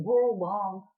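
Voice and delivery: ordinary talk, nothing marked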